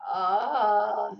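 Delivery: loud, drawn-out "oh" of relief; acted relief. Exhausted mom sinking into an air-conditioned chair, loud relieved groan. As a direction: let out a big mock groan of relief.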